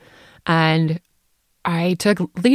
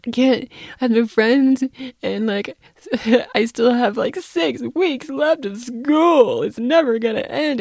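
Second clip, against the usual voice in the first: higher, dragging whine; mock teen despair; 7 semitones higher than usual. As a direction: stretch out the misery like a moping kid. Daughter's despair voiced.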